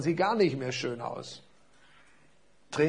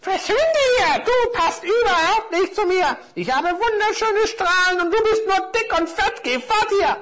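hoch und laut keifende Figurenstimme; kreischend, eitel und zornig; schriller wütender Stern; 8 dB louder than usual. Acted. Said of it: "stieß einen schrillen Schrei aus". (schrill keifender Stern)

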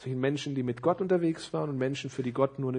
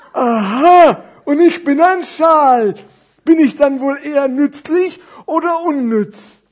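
high, loud, drawn-out character voice; big storybook voice, stretched vowels; naive fat scarf; 12 semitones higher, 17 dB louder, slower than usual. Acted. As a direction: ponder aloud with puppet-show exaggeration. Scarf introduces itself.

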